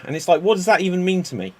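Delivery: ordinary talk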